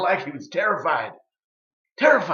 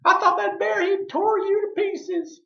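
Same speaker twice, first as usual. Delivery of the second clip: put-on high, louder old woman's cry of relief; relieved granny voice; 5 dB louder than usual. Granny Beecher finding Uncle Ike alive, high relieved voice. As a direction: squeal it out like a frantic granny. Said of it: "She said".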